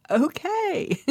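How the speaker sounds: high delighted laugh on okay. Retold or imitated. Meeting Martin Luther, she laughs in delight. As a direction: giggle it out in happy surprise.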